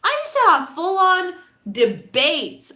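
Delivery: ordinary talk, nothing marked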